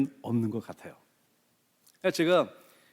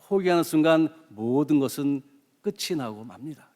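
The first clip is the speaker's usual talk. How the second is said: normal talking voice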